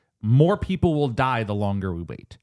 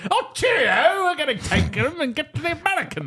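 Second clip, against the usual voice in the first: very high, hammed-up British general; Montgomery caricature. Montgomery as films portray him, high silly caricature voice. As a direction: cry it out high as a silly cartoon Brit. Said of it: "you just wanna do the voice".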